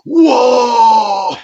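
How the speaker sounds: high, loud, long "whoa"; imitated crowd roar. Fans hearing the swear word, imitated roar. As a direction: mimic fans erupting in shock. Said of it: "so they're all like".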